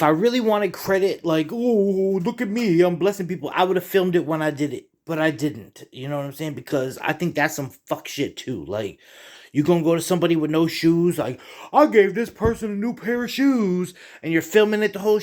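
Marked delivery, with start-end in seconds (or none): 1.5-3.5 mock show-off. Mocking a credit-seeking show-off. 11.7-13.9 mock bragging. Mocking someone filming their charity.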